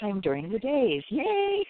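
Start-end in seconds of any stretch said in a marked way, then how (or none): 1.1-1.7 excited cheer. Real, not acted. Celebrating free time, cheering.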